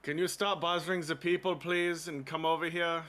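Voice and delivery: high, loud call across the room. Acted. Zahn calling the golem back over. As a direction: call him back from afar.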